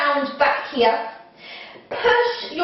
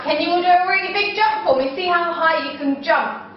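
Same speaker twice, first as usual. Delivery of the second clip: ordinary talk, nothing marked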